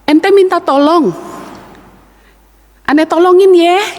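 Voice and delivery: high, chatty Betawi vendor voice; a cheerful peddler offering help; Betawi street vendor. Retold or imitated. Betawi asinan seller answering the man in the well, high and breezy. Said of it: "katanya si orang Betawi ini".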